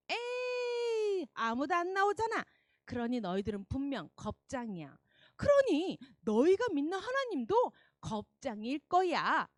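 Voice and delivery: high, loud mocking taunt; mustache twirling villain. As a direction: jeer at the whole army. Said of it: "의기양양해서".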